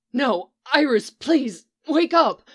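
building from pleading to a high, loud cry; desperate cry. Acted. Barry begging lifeless Iris to wake, desperate rising cry. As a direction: beg her to wake, rising into a shout. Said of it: "he cried desperately".

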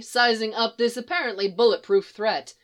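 plain reading voice

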